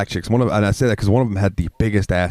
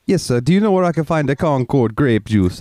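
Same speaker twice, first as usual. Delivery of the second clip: imitated Jamaican lady, higher voice; Jamaican accent; 6 semitones higher than usual. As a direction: answer politely in a put-on Jamaican accent. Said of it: "I love the Jamaican accent".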